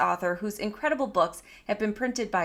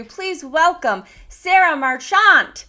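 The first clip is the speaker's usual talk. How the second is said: slow, loud, higher announcer welcome stretching "Sarah Marchant"; grand introduction; 6 semitones higher, 10 dB louder, slower than usual. Shown as a demonstration. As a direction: announce the guest like a game show host, slowly. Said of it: "Did I say it right?".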